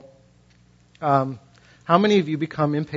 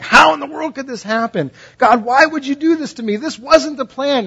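high, loud exasperated protest; frustrated complaint to God; 9 semitones higher, 4 dB louder than usual. Retold or imitated. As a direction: whine at God like your plans were wrecked. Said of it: "You're like".

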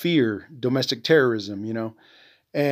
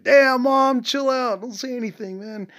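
mock pleading son; 11 semitones higher than usual. Son retells his reply to mom.

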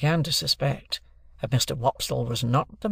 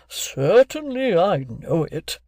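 slow, high, drawn-out pompous voice; Wopsle; 5 semitones higher, 4 dB louder, much slower than usual. A narrator's reading. Wopsle answers the bullying stranger, slow and pompous. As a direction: stretch it out with wounded dignity.

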